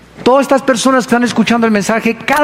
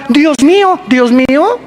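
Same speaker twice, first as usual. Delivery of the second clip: mock panicked cry; 5 semitones higher, slower than usual. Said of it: "ateos que gritaban".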